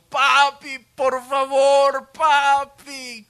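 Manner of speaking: loud high tearful plea; pleading cry. Retold or imitated. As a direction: beg for daddy through tears. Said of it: "verte llorando y diciendo".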